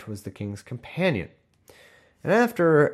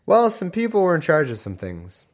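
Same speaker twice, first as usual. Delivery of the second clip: mocking summary; 7 semitones higher, 4 dB louder than usual. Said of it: "summed up by saying".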